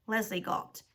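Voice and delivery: usual speaking voice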